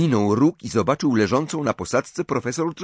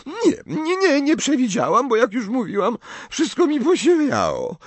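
Professor Trelawney; 3 dB louder than usual. Acted. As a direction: play the tipsy, offended seer, pitched way up.

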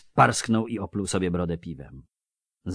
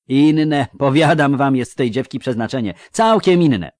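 raised, emphatic dwarf voice; louder, forceful character speech with weighty pauses; adamant dwarf; 5 semitones higher, 8 dB louder than usual. Acted. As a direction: vouch for the girl with conviction. Voices dwarf Yarpen insisting.

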